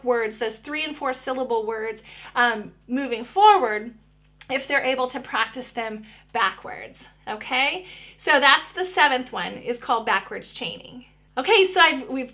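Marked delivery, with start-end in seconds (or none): none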